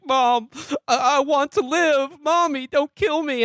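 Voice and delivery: high-pitched hammed-up wail; whiny, fake-tearful begging; mock crying. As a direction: plead like a bawling child, mockingly. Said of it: "this guy's crying, just being like".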